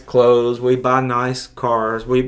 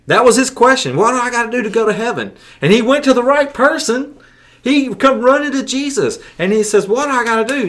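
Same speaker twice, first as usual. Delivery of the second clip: fervent preaching; 11 semitones higher, 4 dB louder than usual. Acted. Preaching the climax of the story.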